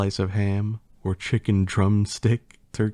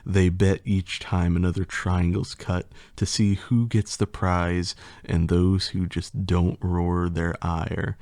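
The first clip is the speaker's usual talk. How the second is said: usual speaking voice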